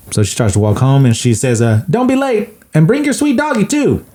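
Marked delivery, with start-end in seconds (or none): 1.9-4.0 put-on high sweet girlish call; much higher little Cindy Lou voice. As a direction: call him like a small girl.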